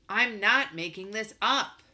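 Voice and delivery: snapping back far too loud; loud snap. A narrator's reading. Dash snaps at his parents, too loud. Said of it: "I snapped, way too loud".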